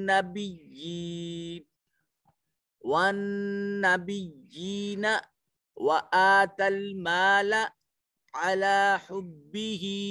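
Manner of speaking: slow, drawn-out melodic tajweed recitation; Quran recitation. Shown as a demonstration. Teacher recites a verse for students, slow melodic chant. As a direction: chant each phrase slowly with long vowels.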